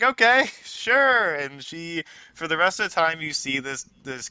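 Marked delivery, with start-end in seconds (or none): 0.0-1.3 high, loud, drawn-out agreement; baffled, exaggerated acceptance; bemused going along. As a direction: humour them, stretching it out slowly. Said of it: "kinda wraps it up and is like".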